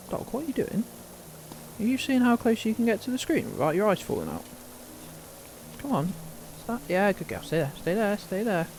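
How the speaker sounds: high coaxing voice for the dog; high, fond dog-owner voice; pet talk. Real, not acted. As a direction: sweet-talk the dog to stay put. Speaking to the dog.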